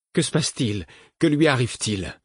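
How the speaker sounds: high, urgent frightened questions; alarmed cry. A narrator's reading. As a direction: call out in sudden alarm. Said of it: "s'écria le fou".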